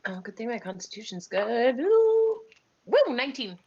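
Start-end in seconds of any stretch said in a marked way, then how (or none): none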